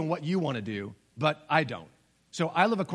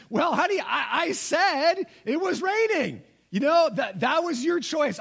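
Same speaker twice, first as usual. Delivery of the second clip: teasing dad; 9 semitones higher than usual. Retold or imitated.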